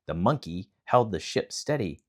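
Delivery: usual speaking voice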